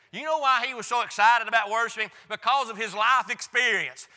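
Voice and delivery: loud, rising preacher's climb; full-force preaching. Acted. Preacher to his church, ringing out loud.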